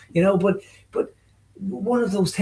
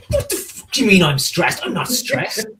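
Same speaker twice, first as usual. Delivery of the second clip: high-pitched mock outburst of defensiveness; retold snap of protest, rising higher; indignant denial; 8 semitones higher than usual. Retells own defensive outburst at colleague.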